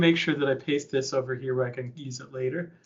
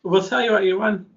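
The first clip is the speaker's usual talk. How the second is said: ordinary delivery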